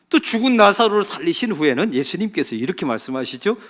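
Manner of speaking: usual speaking voice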